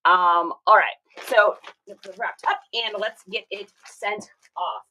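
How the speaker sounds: ordinary delivery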